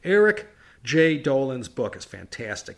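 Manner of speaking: usual speaking voice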